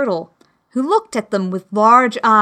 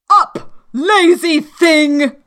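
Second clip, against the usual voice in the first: high, loud, slow royal command; imperious queen; 8 semitones higher, 6 dB louder, slower than usual. Acted. Queen rousing the sleeping Griffin, high and loud. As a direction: bark the order down at a lazy servant.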